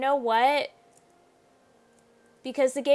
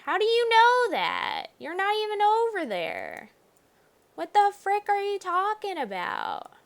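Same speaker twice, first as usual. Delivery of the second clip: higher, indignant comeback; incredulous retort; 10 semitones higher than usual. Real, not acted. Player talking back to the game's narration, indignant. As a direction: scoff at the game's claim. Arguing back at the game text.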